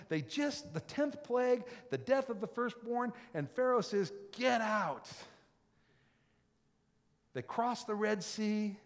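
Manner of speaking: loud, high storytelling preacher; loud sermon storytelling; fired-up preaching. Real, not acted. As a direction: preach it out big. Preaching at full force, story.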